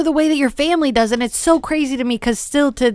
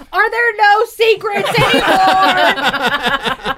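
high, drawn-out mock holler; hammed-up, stretched high yell; retold scream; 9 semitones higher, much slower than usual. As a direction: imitate someone screaming down the stairs, slowly and high. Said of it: "screams down the stairs".

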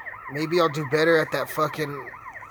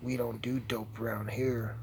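low, hushed voice, much softer; low stern mutter; 7 semitones lower, 9 dB quieter than usual. Acted. To someone dealing dope around here, low and hushed. Acts both sides of exchange.

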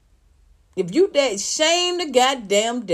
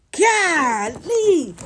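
ordinary delivery

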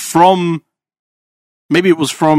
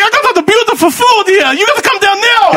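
high-pitched, hammed-up Italian accent; exasperated Italian driver caricature; thick Italian accent. Imitating the delivery driver on the phone, thick Italian accent. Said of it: "I can't not do it with the accent".

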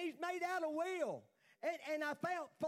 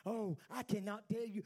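normal talking voice

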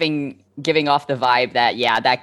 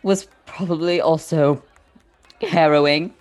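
tearful. Acted. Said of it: "Rowan's like".

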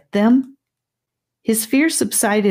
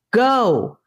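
firm command; 7 semitones higher than usual. A narrator's reading. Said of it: "even more firmly this time".

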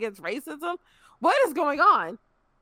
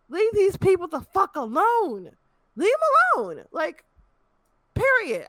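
exasperated scolding; 7 semitones higher than usual. Real, not acted. Scolding fans again.

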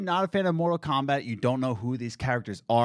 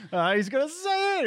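high, thrilled anticipation squeak; excited squeal; 12 semitones higher than usual. Retold or imitated. Fan bracing for the famous line, high excited squeak. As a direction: squeak it out like a fan about to burst. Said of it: "I was like".

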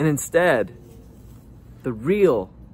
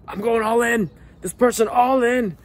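high eager voice; eager quote; 9 semitones higher, 4 dB louder than usual. Retold or imitated. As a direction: play the over-keen dater. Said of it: "You've essentially just said".

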